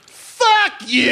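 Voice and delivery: put-on high-pitched drunken heckle; mimicked heckler scream. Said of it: "She just starts screaming".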